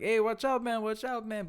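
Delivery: lightly acted heads-up to a workmate; imitated warning. Acts out worker warning his partner.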